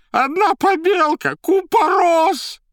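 loud, very high drawn-out shriek; horrified babbling. A narrator's reading. Terrified informer babbling excuses, slow high shriek. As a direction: shriek nonsense in sheer terror. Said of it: "в ужасе понёс какую-то околесину".

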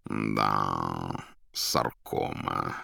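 low, slow, softly relished; savouring. A narrator's reading. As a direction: purr the word like a contented cat. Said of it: "жмурясь, как кот, повторил он звучное слово". (низко, медленно, смакуя слово)

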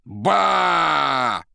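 громкий, высокий, протяжный возглас; заорать вдруг, растянув слог; внезапный вопль. A narrator's reading. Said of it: "вдруг заорала Зазелла". (внезапно заорать)

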